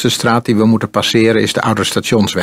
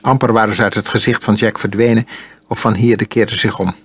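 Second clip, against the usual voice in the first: ordinary delivery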